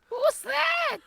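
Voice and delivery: very high-pitched questioning squeak; put-on silly voice. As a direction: chime in with a tiny high cartoon voice. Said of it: "I just wanted to do a voice too".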